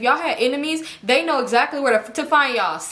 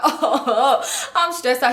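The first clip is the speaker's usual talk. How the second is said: laughing nervously; much slower than usual. Real, not acted. Dog sniffing them, nervous.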